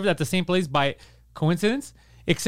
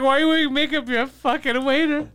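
mocking celebrity chef; 7 semitones higher than usual. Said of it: "They're like".

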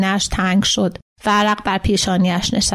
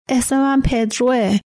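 high, drawn-out mild plea; pleading cry; 4 semitones higher than usual. A narrator's reading. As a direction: plead it slowly in a raised pitch. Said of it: "با صدایی تیز و استغاثه کننده".

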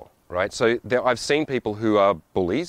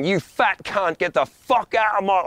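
mock abusive outburst; 10 semitones higher than usual. Traveller pretending to abuse someone in the way, mock fury. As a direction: hurl a hammed-up insult. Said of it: "I'm not making personal attacks".

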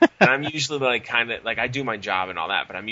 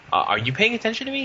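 incredulous; 13 semitones higher, 3 dB louder than usual. Retold or imitated. Confronting manager on phone.

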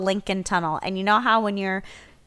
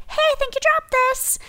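very high, put-on friendly call; mock-helpful chirp to a stranger; nice, cheery. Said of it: "some sort of nice, cheery".

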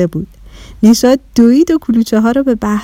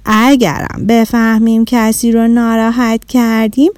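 plain reading voice

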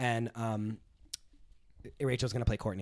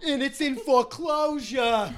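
slow, higher, mocking jab; snide taunt; 9 semitones higher, 4 dB louder, slower than usual. Playing Danielle, slowly taunting Teresa about her house. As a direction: drag out the dig.

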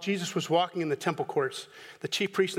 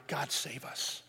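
whispered and much softer; crying out, turned to a whisper; hushed crowd cry; 7 semitones lower, 12 dB quieter than usual. Retold or imitated. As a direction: breathe it like a secret hope. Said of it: "they're crying out".

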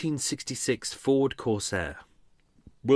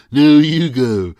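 loud slow playful insisting; hurried goodbye; 6 semitones higher, 12 dB louder, much slower than usual. Pretend. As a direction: shout it out, refusing to hang up first. Mock lovers won't hang up.

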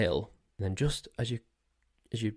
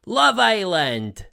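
loud, high, drawn-out show announcer; Ian Sterling impression; 10 semitones higher, 8 dB louder, much slower than usual. Podcaster mimicking the Love Island narrator, loud high announcer shout. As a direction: shout it long and high like a TV voice-over. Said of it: "like Ian Sterling".

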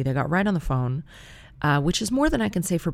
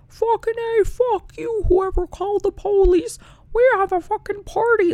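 very high, loud falsetto party yell; cartoon-pitched drunken bellowing; Mickey Mouse drunk; 6 dB louder than usual. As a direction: imitate a squeaky, exuberant drunk man who sounds like a woman. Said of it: "Mickey Mouse level of drunk asshole".

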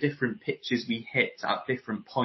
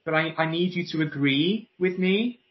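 higher pitch, every phrase rising for agreement; insecure upspeak; 6 semitones higher than usual. Acted. Voice coach voicing an unsure speaker, insecure upspeak. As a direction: plead lightly for agreement with questioning endings. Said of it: "Sounds insecure".

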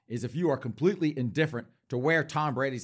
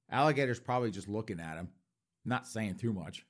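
usual speaking voice

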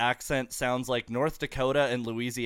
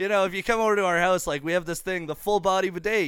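raised, building comic pitch to guests; mock sales pitch; 5 semitones higher than usual. Telling houseguests about the full body bidet, mock-helpful pitch. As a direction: sell it like a handy feature, rising to the punchline.

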